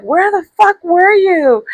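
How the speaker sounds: high, slow indignant demand; retold anger. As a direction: draw out the mad question, retelling it. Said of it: "I was so mad".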